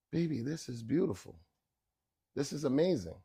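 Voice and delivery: soft, hushed praise; quietly awed; touched mother. Retold or imitated. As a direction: imitate a moved mother, softly. Mother moved by son's food.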